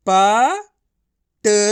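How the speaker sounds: very slow, each syllable drawn out. Shown as a demonstration. Teacher sounding out the word letter by letter for pupils, drawn out very slowly. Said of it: "मैं किस तरीके से एक एक अक्षर का उच्चारण करके".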